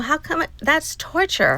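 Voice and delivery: high-pitched retold objection; pleading protest. Cancer patient calls the long wait torture, pleading protest.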